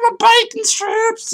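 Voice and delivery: very high, drawn-out squeal; goofy catchphrase voice. Acted. Host signing off to viewers, goofy high drawn-out catchphrase. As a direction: stretch it out high and silly.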